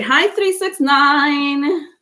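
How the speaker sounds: ordinary talk, nothing marked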